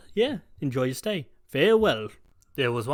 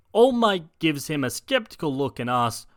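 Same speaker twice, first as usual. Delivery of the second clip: plain reading voice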